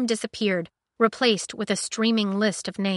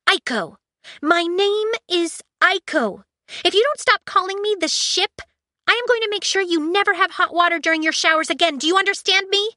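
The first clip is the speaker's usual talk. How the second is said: high loud threatening android; high shrill ultimatum; furious ship AI; 11 semitones higher, 5 dB louder than usual. Acted. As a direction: snap it as an insulted AI. Aiko threatens.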